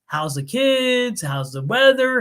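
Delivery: slow, drawn-out Southern friendly questions; slow Southern small talk. Retold or imitated. Southern neighbours chatting before business, in a slow Southern drawl. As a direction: stretch every word like a Texan neighbour. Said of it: "In the South, especially in Texas".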